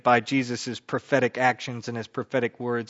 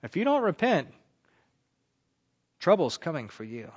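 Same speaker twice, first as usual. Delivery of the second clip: sterner warning; 3 semitones higher than usual. Retold or imitated. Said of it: "Then they might go".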